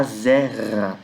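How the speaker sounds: "Zerra" with long stressed ZÉ; slow model ZÉÉ-ra; stress on first syllable. Shown as a demonstration. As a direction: draw out the first syllable of "Zerra", then fall. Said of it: "Lembrando a sílaba tônica aqui".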